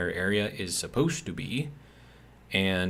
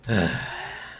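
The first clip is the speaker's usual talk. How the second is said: sigh. Real, not acted. Weary after venting about coworker.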